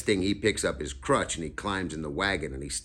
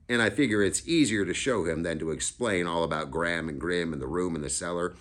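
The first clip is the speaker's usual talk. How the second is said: ordinary delivery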